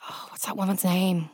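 a hushed aside while searching for a name; whispered aside. Real, not acted. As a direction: whisper it while trying to remember.

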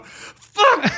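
high-pitched outburst, acted through laughter; losing it. Sheriff losing it over heart murmur news, explosive high yelp. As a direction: blurt it high, as if totally snapping. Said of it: "he just loses shit".

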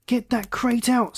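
high, drawn-out urgent cry; slow, high-pitched command; roared order. A narrator's reading. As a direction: roar it with the pitch up, volume held back. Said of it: "Scott roared".

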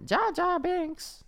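high, slow, squeaky alien voice; high drawn-out creature impression; Jar Jar Binks. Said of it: "Is that accurate?".

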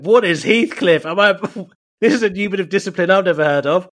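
loud, high baffled protest; mock bewilderment. Telling how mum's Heathcliff lesson baffled him, mock bewildered. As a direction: play the confused child, loudly. Acting bewildered younger self.

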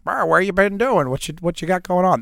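mock Southern dad on the phone; exaggerated dad voice. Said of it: "That's not his accent. I'm just saying, you know, uh, to distinguish it".

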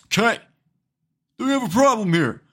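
loud, high, fast challenge; mock tough guy. Mock confrontation after rant.

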